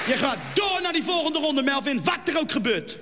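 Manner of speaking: put-on pushy dad in a broad Utrecht accent; a father in thick Utrecht dialect, pushing his son to enter. Dad urges son to enter Idols, broad Utrecht accent. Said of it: "door zijn vader wordt verplicht".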